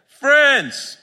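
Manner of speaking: high, loud hail across water; calling out. Acted. Jesus hailing the disciples from shore, calling out. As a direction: call out to distant friends, drawn out. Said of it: "He called out to them".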